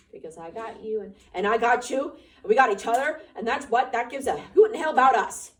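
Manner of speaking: loud, joyful triumph; triumphant. Acted. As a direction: cry out in triumph. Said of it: "Lenny cried in triumphant".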